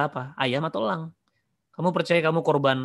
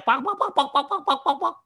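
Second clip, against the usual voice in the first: high-pitched panicked hen clucking; imitated chicken cries, rapid and high; squawking chicken; 11 semitones higher than usual. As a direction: squawk like a chicken running away. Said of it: "kamu lari sambil teriak-teriak".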